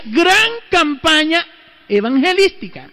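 high, emphatic announcer-like reading; poster proclamation. Retold or imitated. Priest reading out the Protestants' poster, high proclaiming voice. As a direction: proclaim each item like a headline.

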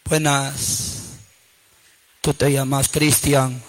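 very low, slow voice; soft and drawn out, like Death at the door; Death calling. Acted. Death visits a guilty sleeper at night, low slow menace. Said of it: "en la noche le toca la huesuda".

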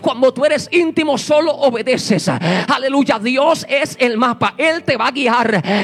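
loud, driving sermon voice with hammered refrains; full-force preaching. Acted. Preacher exhorting the congregation about intimacy with God, loud and driving. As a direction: preach it out loud to the whole church.

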